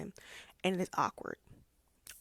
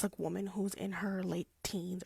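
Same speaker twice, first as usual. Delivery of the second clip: usual speaking voice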